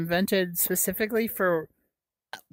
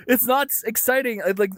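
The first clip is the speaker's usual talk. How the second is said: ordinary delivery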